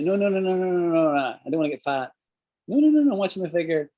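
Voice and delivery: high, quick flustered refusal; playful mock protest, voice jumping up. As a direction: protest the cake in a playful panic. Guest refusing offered cake.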